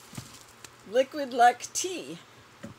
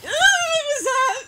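high, loud startled cry; startled shriek; 12 dB louder than usual. Real, not acted. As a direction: shriek in sudden fright. Startled by snake in box.

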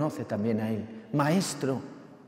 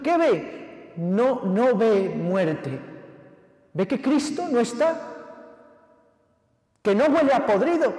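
loud, high, impassioned preaching; full-force preaching; 6 semitones higher, 6 dB louder than usual. Acted. Preacher urging his congregation, preaching at full force. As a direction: drive it home to the congregation.

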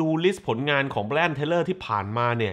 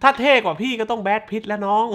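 higher-pitched, energetic promo read; sponsor ad read; 7 semitones higher than usual. Acted. Host plugging Optus support for Thai athletes, ad read. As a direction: pitch it up like an ad plug.

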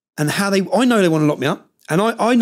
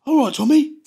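high, sing-song all right Tommy; a knowing, high hello from the van; mock friendly greeting; 9 semitones higher than usual. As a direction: imitate the cheeky greeting up high. Man in van greets him.

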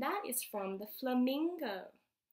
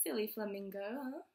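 ordinary delivery, nothing marked

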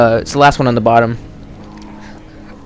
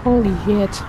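high shocked squeal; 13 semitones higher than usual. Real, not acted. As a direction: squeal in disbelief. Reacts to partner's move.

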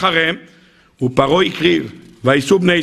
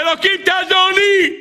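desperate cry again; 13 semitones higher than usual. Acted. Climber hanging on a cliff cries out to God desperately. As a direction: scream up to God as you fall.

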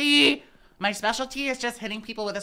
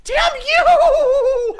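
high, loud laughing curse; laughing shout; 13 dB louder than usual. Real, not acted. Killed by an enemy, laughing shout. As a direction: yell it through laughter.

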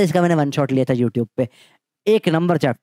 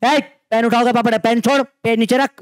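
mock stern teacher telling off a student; stern teacher scolding; 7 semitones higher than usual. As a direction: snap at a student to put the pen down. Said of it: "तो जब मैं बोलूं".

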